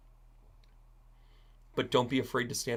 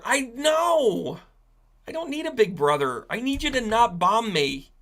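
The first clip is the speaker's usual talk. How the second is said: defiant high shout; 10 semitones higher, 5 dB louder than usual. Retold or imitated. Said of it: "Ukraine is saying".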